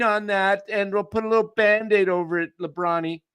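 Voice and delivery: put-on baby him like an overprotective mom; mock doting mama. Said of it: "like mama's coming".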